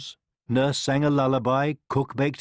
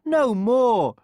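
high, loud shriek of refusal; shrieked royal protest; screaming; 12 semitones higher, 6 dB louder than usual. A narrator's reading. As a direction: scream it like an outraged queen. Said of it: "the queen screamed".

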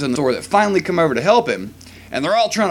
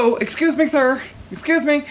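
higher, mock-polite hail to staff; polite calling out; 10 semitones higher than usual. Customer trying to get ignored employees' attention, higher and polite. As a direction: wave down a clerk who won't look up.

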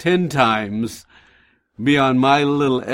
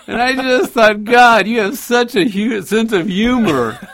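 laughing; 10 semitones higher, 6 dB louder than usual. Real, not acted. Laughing retelling of the snake moment.

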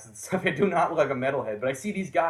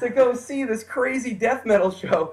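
higher, louder, laughing as the metalheads; psyched metalhead; 9 semitones higher, 7 dB louder than usual. Retold or imitated. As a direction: grin through it, delighted to adopt the kid. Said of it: "They're like".

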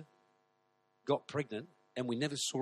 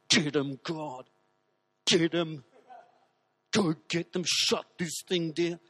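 fierce inner cry; 3 semitones higher, 7 dB louder, slower than usual. Retold or imitated. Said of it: "on the inside, she's going".

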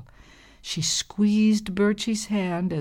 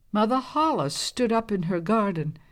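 usual speaking voice

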